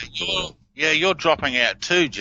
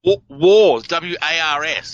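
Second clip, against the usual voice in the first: wars said louder, then spelled W A R S letter by letter; spelling out wars; 4 semitones higher, 5 dB louder than usual. Shown as a demonstration. Correcting the host who misheard wars as walls. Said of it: "Sorry, that's my accent".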